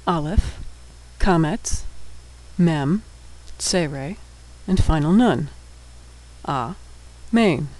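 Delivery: letter by letter, then syllable by syllable; sounding out. Shown as a demonstration. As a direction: spell it out with pauses, then say the whole word. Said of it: "we'll be sounding out all of the words".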